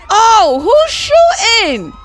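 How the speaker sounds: high, loud, drawn-out startled cry; startled yell. Real, not acted. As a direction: cry out in high-pitched alarm. Startled by gunfire in game.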